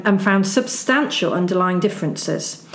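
usual speaking voice

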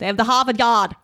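dropped r Boston Harvard Yard, lightly done; a hint of a Boston accent on Harvard Yard; playful Boston twang, r dropped; mock Boston accent. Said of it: "Definitely how they talk".

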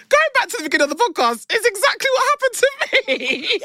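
high, helpless laughter through the words; laughing hard. Real, not acted. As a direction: laugh it out in disbelief, near tears. Friend forgot the triggering plot.

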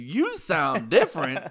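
"You" drawn out in a slow retort; stress on YOU. Retold or imitated. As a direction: turn it back with weight on "You". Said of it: "And I was like".

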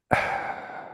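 long, soft exhale, acted out; deflating sigh. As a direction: let all the air out slowly. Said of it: "you can hear me go".

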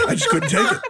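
high, giggling words; laughing while talking. Real, not acted. Guy telling his cast story, laughing through the words. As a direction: laugh the line out.

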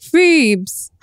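lightly voiced high Ross; Ross imitation. Host imitating how Ross says Phoebes. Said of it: "Or when Ross is like".

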